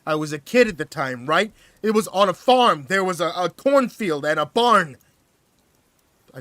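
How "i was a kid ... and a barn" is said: demanding. A narrator's reading. Narrator demanding answers from hostage, loud and insistent. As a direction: press him hard for answers. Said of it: "I demanded".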